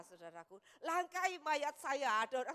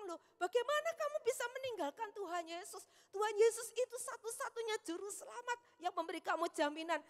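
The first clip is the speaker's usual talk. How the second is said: shocked rebuke; 6 semitones higher than usual. Retold or imitated. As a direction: exclaim in disbelief at her leaving the faith. Pastor rebukes the woman by phone. (pendeta menegur jemaat yang meninggalkan iman, nada tinggi terkejut)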